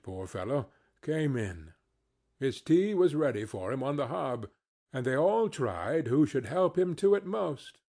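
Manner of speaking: ordinary delivery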